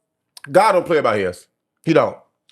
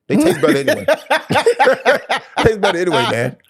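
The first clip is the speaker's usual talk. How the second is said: laughing; 4 semitones higher than usual. Real, not acted. Laughing at own joke.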